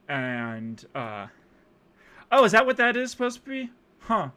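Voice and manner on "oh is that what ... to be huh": a high, loud mock realisation; sarcastic surprise. Real, not acted. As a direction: act like it just dawned on you.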